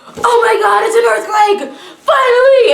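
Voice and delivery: very high, loud retold squeal; excited screaming. Reliving a first earthquake for the audience, excited screaming. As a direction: squeal as if thrilled it finally came. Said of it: "like, screaming".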